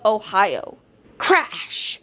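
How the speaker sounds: usual speaking voice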